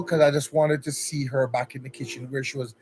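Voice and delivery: usual speaking voice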